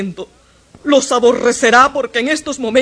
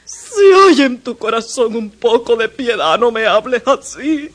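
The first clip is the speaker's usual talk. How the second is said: tearful plea; 3 semitones higher than usual. Acted. Mother begging her son for mercy, crying out. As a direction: implore him for mercy.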